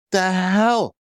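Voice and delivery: high, drawn-out incredulous question; baffled, high-pitched outburst; retold bewilderment. Retold shock at laughing doctors.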